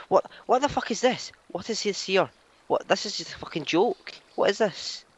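high-pitched, incredulous questioning; baffled outrage. Real, not acted. Gamer confronted by a bad surprise, incredulous. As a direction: protest in rising disbelief.

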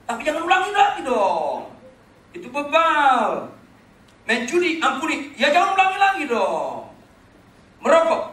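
loud rising rebuke at full preaching force; scolding shout. Acted. Pastor scolding repeat sinners, loud rebuke. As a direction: hammer home "jangan ulangi lagi dong" to sinners.

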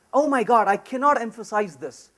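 normal talking voice

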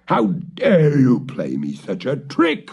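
a touch of a furious witch yell; raging shout. Acted. The witch shouts at the cat, mildly furious. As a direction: scold the cat in a rage. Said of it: "shouted Baba Yaga to the cat in a rage".

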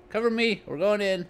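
high mock commando call; hammed-up soldier shout; cover me shout. Mock commando entering building.

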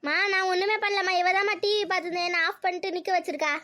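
high, whiny tattling; protesting. Acted. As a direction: plead innocence and blame your sibling. Daughter protests to mother.

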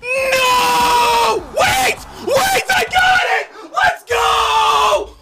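loud high panic to triumph; excited scream. Real, not acted. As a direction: shriek then cheer the win. Near loss then win.

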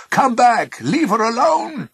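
high, loud bawl after someone fleeing. A narrator's reading. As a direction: bawl it out at full volume. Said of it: "The trees bawled after Pluto".

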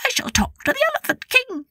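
high, quick mouse voice; very high-pitched little king. A narrator's reading. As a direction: declare it as a tiny mouse monarch. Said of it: "said the King of the Mice".

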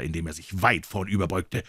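plain reading voice

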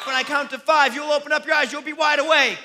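high, loud rising shout; loud wake-up count. Real, not acted. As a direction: build to a shouted command. Hypnotist loudly wakes volunteers.

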